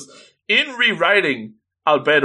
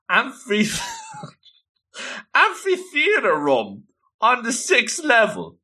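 laughing; 9 semitones higher than usual. Real, not acted. Laughing at fancy word.

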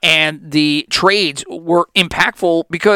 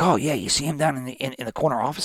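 hushed, hurried office murmur; softer coworker voice, half-hushed; 2 semitones lower, 8 dB quieter, faster than usual. Retold or imitated. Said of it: "Why are we all whispering here".

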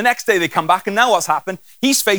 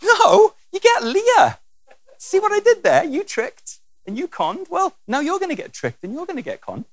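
teasing God; 11 semitones higher than usual. Acted. God is having a laugh.

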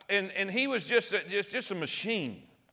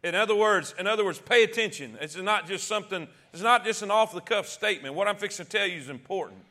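ordinary delivery

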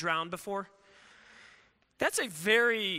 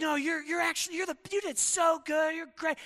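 higher eager friends gushing praise; lightly voiced gushing friends; a hint of over-eager reassurance; mock reassurance; 6 semitones higher than usual. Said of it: "everyone's like".

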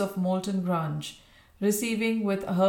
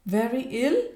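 high, startled echoing question; startled, rising query; alarmed exclamation; 4 semitones higher, 4 dB louder than usual. A narrator's reading. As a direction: exclaim it in shocked disbelief. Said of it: "exclaimed Bella".

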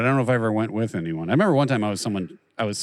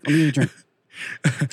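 slow, higher, hammed-up offer; mock creepy suitor; 5 semitones higher, much slower than usual. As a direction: drag out the offer like a creepy flirt. Mocking guy offering water bottle.

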